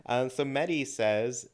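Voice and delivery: normal talking voice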